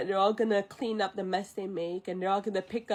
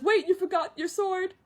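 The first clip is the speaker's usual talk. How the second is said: high, urgent retold call; calling after someone; 9 semitones higher than usual. Mushu calling after Mulan leaving the tent, high call. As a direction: call it out in a raised pitch.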